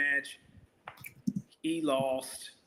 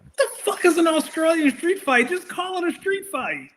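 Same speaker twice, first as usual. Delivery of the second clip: incredulous; 11 semitones higher, 5 dB louder than usual. Real, not acted. Mock outrage at match.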